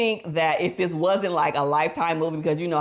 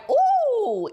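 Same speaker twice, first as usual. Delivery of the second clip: long, very high "ooh"; struck "ooh"; 3 dB louder than usual. Retold or imitated. Reacting to a wife's honest words, high admiring ooh. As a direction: let out a drawn-out, high-pitched ooh.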